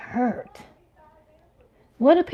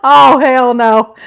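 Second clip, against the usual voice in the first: sassy hell no; 10 dB louder, slower than usual. Real, not acted. Repeats indignant refusal.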